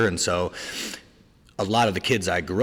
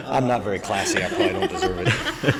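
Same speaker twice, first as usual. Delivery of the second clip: laughing through the words; 6 semitones higher, 6 dB louder than usual. Real, not acted. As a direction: giggle while admitting it. Laughing at being unclassy.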